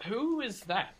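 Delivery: high-pitched questioning call; shopkeeper's voice, pitched well up; suspicious shopkeeper. Acted. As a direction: call out sharply to whoever is there. Shopkeeper calls out in game.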